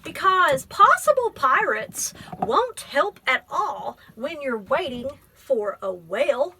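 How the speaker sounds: gentle, measured picture-book reading; teacher reading a poem book aloud to a child; reading to children. Acted. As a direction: read it like story time.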